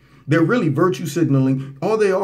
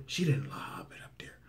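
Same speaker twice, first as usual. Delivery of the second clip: whispery, low mockery of a gentle lob; mock soft voice; 8 semitones lower, 16 dB quieter than usual. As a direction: drop to a scoffing near-whisper. Mocking the soft lob claim.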